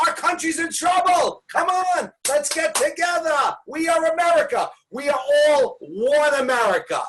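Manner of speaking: high-pitched, impassioned mock plea; rally the nation with hammed-up urgency; mock rallying cry. Comedian pleading with America to get together, rousing.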